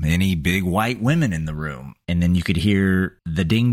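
normal talking voice

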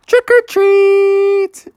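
high, slow sing-out; kids trick-or-treating. Acted. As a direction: call it out in a high child's voice. Skeleton kids at the door.

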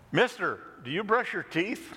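a hint of a child's piping question; little girl voice. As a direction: ask like a small bold girl.

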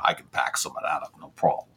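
put-on low, soft Long Island accent; a quick Long Island accent bit. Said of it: "If you want some Long Island".

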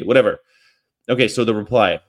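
ordinary talk

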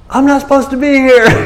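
Isaiah losing it in the back of heaven, half joking; mock freak-out. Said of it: "He just starts freaking out".

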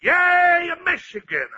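high cheering shout that drops off; imitating the crowd's cheer. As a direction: cheer like the whole stand. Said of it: "the people around you is all gonna yell".

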